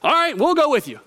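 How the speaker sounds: breezy mock disciples chiming in; disciples agreeing. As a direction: go along with it casually. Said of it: "The disciples are like".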